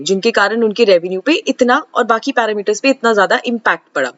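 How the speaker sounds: ordinary delivery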